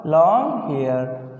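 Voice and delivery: slow, drawn-out long hair; slow dictation. Shown as a demonstration. Teacher repeating long hair slowly for pupils. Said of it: "क्या लिख दिया बेटा".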